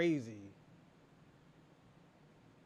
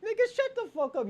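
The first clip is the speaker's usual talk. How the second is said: mocking yell, much higher; 13 semitones higher than usual. Real, not acted. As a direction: yell it way up high, still in the mock voice. Mocking back at a complainer.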